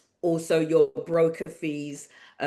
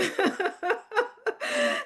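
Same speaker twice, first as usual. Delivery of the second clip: high embarrassed giggle; a real squeal of laughter. Real, not acted. Host still amused by her botched accent, high giggle. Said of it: "That was awful".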